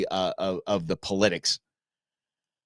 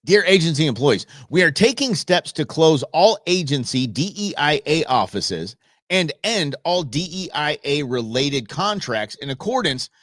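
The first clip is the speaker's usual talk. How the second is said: loud, raised official read; formal letter reading; 4 semitones higher, 4 dB louder than usual. Real, not acted. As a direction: read it out like a memo. Reads official letter loudly.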